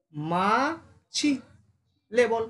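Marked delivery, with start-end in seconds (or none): 0.0-1.3 মৌমাছি stretched out very slowly. Shown as a demonstration. Teacher drawing out মৌমাছি for the class. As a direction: drag মৌমাছি out syllable by syllable. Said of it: "এটা হবে".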